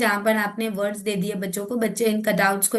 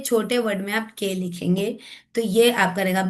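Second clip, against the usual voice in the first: ordinary talk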